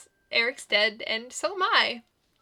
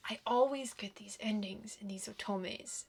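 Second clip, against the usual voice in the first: softer, unvoiced frustrated whisper; 10 dB quieter than usual. Real, not acted. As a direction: whisper it in despair. Upset gamer venting to herself.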